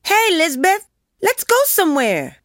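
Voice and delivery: put-on high-pitched, impatient kid's holler; yelling boy. A narrator's reading. Bored Joey calling Lizbeth away from her reverie, hollering. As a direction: call out high, like a boy across a yard. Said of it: "He never talked when he could yell".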